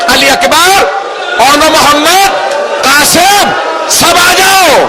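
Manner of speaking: high, drawn-out summons; calling out. Retold or imitated. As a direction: call each one in with a stretched, high cry. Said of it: "جناب عباس نے آواز دی".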